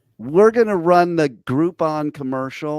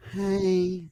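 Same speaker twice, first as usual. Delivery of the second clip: imitating her sexy voice; 6 semitones higher, 5 dB quieter than usual. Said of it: "It's when she has the".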